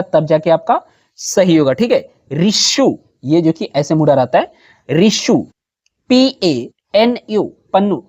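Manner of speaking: ordinary talk, nothing marked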